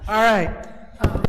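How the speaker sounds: proving the big voice with a loud all right. Real, not acted. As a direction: boom it out to fill the room. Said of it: "I've got a big voice".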